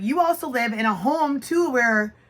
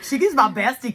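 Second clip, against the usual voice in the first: ordinary talk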